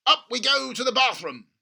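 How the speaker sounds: gushing over a money-making idea; excited father. A narrator's reading. Father urging his son at breakfast, excited. Mr Cranky voiced.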